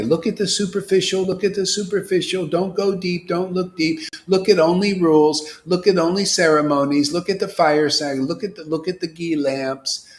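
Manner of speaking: a lightly voiced temptress pulling you shallow; tempting voice of Maya. Maya coaxing devotees toward rituals, a light mock lure. Maya luring people to the surface.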